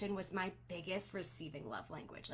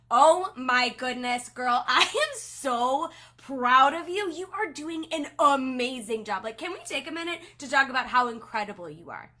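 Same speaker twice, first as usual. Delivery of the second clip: gushing excited praise; 3 semitones higher, 11 dB louder than usual. Shown as a demonstration. Team leader gushing praise at a team member, excited and loud. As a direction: burst with pride, drawing out oh my goodness. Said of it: "Do you hear the difference in that?".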